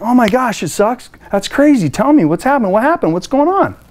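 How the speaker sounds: excited caring questions to an upset caller; eager concern. Shown as a demonstration. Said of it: "What you'd wanna do is be like".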